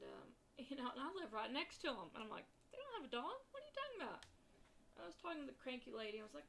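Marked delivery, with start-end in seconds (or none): 2.7-4.2 incredulous. Retold or imitated. Disbelieving reply to cranky neighbour.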